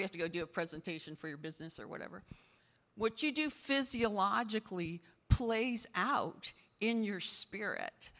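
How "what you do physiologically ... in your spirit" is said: ordinary delivery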